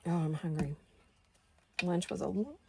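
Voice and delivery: talking with the hiccups; words broken by a hiccup. Real, not acted. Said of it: "I have the hiccups".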